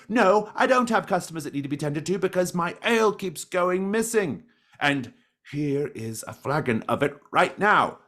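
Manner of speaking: high-pitched indignant tirade; angry rant. Acted. Tavern keeper complaining his ale keeps vanishing, indignant rant. Old man rants about missing ale.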